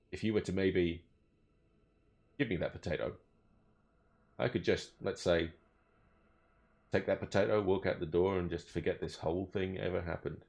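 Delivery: low, soft, sly hushed voice; quietly angle for the potato bribe; shady bribing officer. Acted. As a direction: elf officer softly pushes the halfling for a bribe. Corrupt officer asks for bribe.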